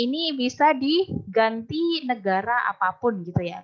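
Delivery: normal talking voice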